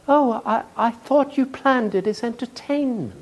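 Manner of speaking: timid woman. Retold or imitated. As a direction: shyly offer the guess. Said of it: "one woman timidly raised her hand".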